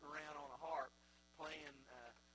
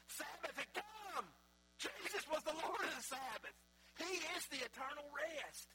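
higher, louder, fervent proclamation; preaching at full force; 8 semitones higher, 6 dB louder than usual. Acted. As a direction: preach it out with fire.

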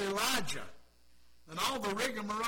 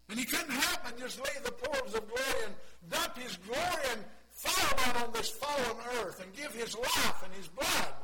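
preaching at full force; 5 semitones higher, 8 dB louder than usual. Acted. Preacher crying out God's love to the church. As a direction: lift it to a shout of praise.

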